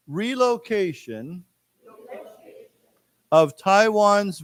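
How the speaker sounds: slow, syllable by syllable, with long pauses; slow, clear teacher reading, phrase by phrase; slow read-along. Shown as a demonstration. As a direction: read it out slowly for the class to repeat. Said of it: "let's say it again".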